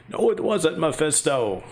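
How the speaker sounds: normal talking voice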